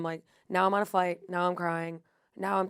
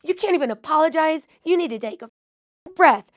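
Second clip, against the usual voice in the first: high, loud, slow imitation of an irate stranger; impersonating the woman who yelled at her; mock angry rant; 8 semitones higher, 8 dB louder than usual. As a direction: ham up the furious woman scolding. Acting out angry airport woman.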